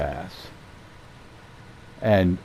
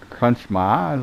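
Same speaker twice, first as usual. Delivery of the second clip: ordinary talk, nothing marked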